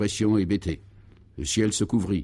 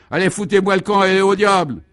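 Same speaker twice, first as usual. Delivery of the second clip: high, loud shouted climax; furious dismissal; 9 semitones higher, 7 dB louder than usual. A narrator's reading. Priest throwing his congregation out, shouting furiously. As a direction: roar them out of church.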